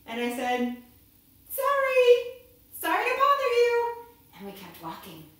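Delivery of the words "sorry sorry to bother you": high, loud sing-out; raised, high-pitched play apology; called-out apology. Acted. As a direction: call it up to someone in a tree. Calls apology to the eagle.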